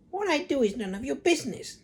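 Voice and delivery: put-on high old hag voice; snappy Grandma. A narrator's reading. Grandma tells George to mind his business. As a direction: tell him off like a mean grandmother. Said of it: "the old woman said".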